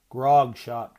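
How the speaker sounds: a spiteful sister's taunt. A narrator's reading. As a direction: throw the words as an insult. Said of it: "taunted Janine".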